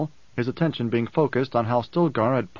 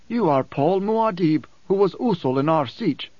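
hint of devoted Korba; 5 semitones higher than usual. A narrator's reading. Korba declares his emperor's names, lightly voiced devotion. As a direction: answer with devotion, pitched up.

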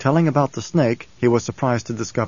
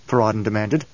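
plain reading voice

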